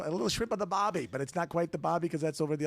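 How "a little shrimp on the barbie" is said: higher, louder mock-Aussie catchphrase; Australian accent. Shown as a demonstration. Co-host likening New Zealand to Aussies, Australian accent. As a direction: put on a broad Australian accent. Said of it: "like the Aussies".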